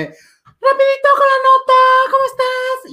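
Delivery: squeaky, loud, bubbly announcer parody; mock perky host. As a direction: play a hyper-cheerful radio presenter. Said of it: "estarías diciéndome".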